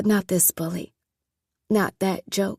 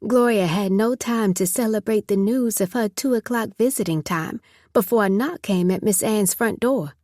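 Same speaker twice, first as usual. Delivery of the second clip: ordinary delivery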